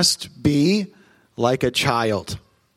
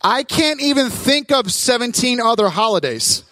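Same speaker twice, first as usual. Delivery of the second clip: much higher, laughing indignation; mock outrage; 9 semitones higher than usual. Real, not acted. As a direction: complain in comic disbelief. Mock outrage about Father's Day.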